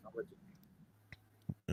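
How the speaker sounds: lagging, cutting-out audio; choppy call audio. Real, not acted. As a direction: voice breaking up on a bad connection. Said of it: "you froze a little bit".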